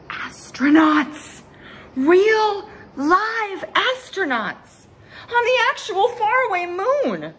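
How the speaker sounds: awed excitement. A narrator's reading. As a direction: stretch each word out in amazement. Astronauts on TV, awe.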